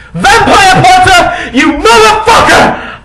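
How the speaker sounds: high, loud slow scream; furious yell. Acted. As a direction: scream across the classroom. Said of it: "I yelled".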